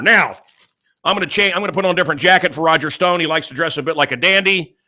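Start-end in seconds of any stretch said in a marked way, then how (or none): none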